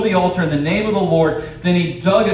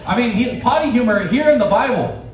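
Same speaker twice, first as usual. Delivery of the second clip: ordinary delivery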